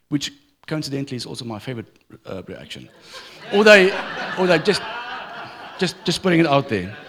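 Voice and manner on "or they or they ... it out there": laughing through the words, louder. Real, not acted. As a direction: chuckle while saying it. Laughs at his own joke.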